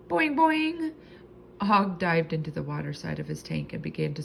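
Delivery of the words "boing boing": high, loud springy twangs; frog boing. A narrator's reading. Frog protesting staying behind, loud high boings. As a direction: bounce out two sharp frog calls.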